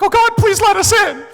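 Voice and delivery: very high, loud begging cry; mock desperate plea. Stranded family pleading at the hotel gate, mock desperate cry. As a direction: beg to be let in, hammed-up and shrieking high.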